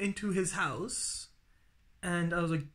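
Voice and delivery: usual speaking voice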